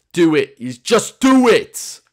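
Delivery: loud, high, stretched shout; motivational yell. Acted. Gamer quoting a pep talk, hammed-up motivational shout. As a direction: bellow it like an over-the-top pep talk.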